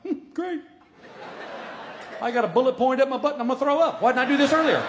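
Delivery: high, loud, fast rattled rant; nervous passenger ranting about throwing up; panicked sarcasm. Retold or imitated. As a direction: freak out in a high rush.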